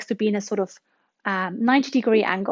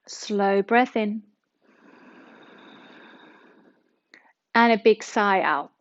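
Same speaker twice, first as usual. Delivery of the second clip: guided meditation. Acted. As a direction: ease them into relaxation. Leads a guided relaxation.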